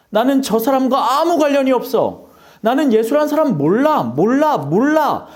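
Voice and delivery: loud, rising panic of a man denying his teacher; panicked Peter insisting he knows nothing; frantic denial. Acted. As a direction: blurt it out, desperate to be believed. Peter denies Jesus, acted.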